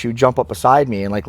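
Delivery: ordinary talk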